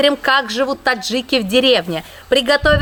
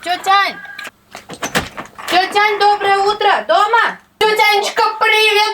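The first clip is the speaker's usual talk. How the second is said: high calling voice across a yard; 6 semitones higher than usual. Real, not acted. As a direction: call out to someone indoors. Calling auntie outside her house.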